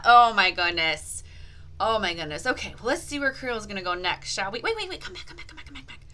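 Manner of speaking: ordinary talk, nothing marked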